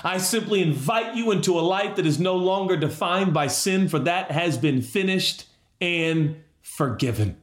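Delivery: preacher emphatically echoing Jesus to every listener; emphatic pardon. Acted. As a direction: declare it with full conviction, as Jesus did. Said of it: "I just want to say emphatically".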